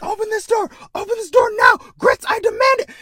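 very high, loud mock scream; Twi'lek screams at Grits to open the door; screaming demand. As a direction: scream through the comm to be let in. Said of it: "screams in her comm".

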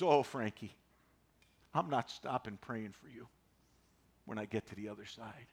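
tender mother-in-law. Retold or imitated. Dying mother-in-law promising to keep praying, soft and tender. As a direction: hush it like a frail saint comforting her son-in-law.

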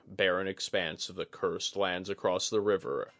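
ordinary delivery

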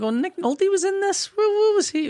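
high puzzled squeak of disbelief; confused surprise. Retold or imitated. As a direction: jump way up in pitch, baffled. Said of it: "I was like".

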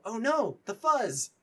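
high, loud mock alarm; high-pitched cartoonish dread; comic panic. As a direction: cry out in hammed-up dismay. [name] panics as the police arrive.

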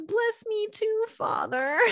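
very high, tearful imitation; mock sobbing plea. Esau begs his father for a blessing, mock sobbing. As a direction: plead high like a weeping child. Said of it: "Esau starts sobbing uncontrollably".

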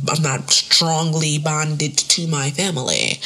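slow, drawn-out over-enunciation; oddly deliberate phrasing. Real, not acted. Podcaster hedging about family ties, oddly deliberate. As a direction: stretch the words with odd deliberateness. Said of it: "I don't know why I said it like that".

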